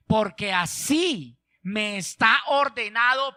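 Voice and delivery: very slow, deliberate pulpit delivery; declamatory scripture reading. Real, not acted. Prophet refusing the king's offer, slow and emphatic. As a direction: weigh every word of the refusal.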